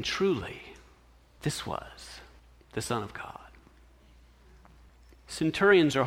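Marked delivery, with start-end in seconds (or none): 0.0-3.5 soft, near-whispered awe; the centurion, shaken and afraid; much softer, awed hush; fearful awe. Acted. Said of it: "they feared greatly".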